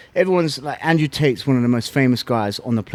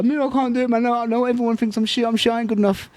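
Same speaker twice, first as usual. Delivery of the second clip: much higher pleading voice; mock whiny self; 11 semitones higher than usual. Fighter telling coach he can't do it, high whiny plea. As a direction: play his younger self begging off the fight. Retelling his own doubts to coach.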